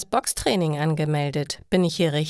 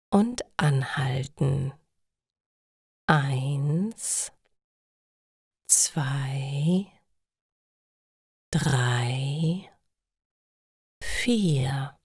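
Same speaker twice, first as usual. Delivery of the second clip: counting breaths; 4 semitones lower, much slower than usual. A narrator's reading.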